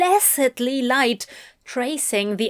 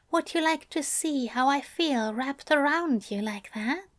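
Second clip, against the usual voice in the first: higher, softer, lingering lover's voice; Aziraphale, seductive; 4 semitones higher, 4 dB quieter than usual. A narrator's reading. Aziraphale tempting Crowley, soft and higher-pitched. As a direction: coax him gently, drawing each phrase out.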